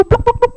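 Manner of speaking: playful high pluk pluk; high plopping sounds. Acted. Storyteller making turtle sounds for children, high playful plops. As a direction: bounce each pluk up high like a toy.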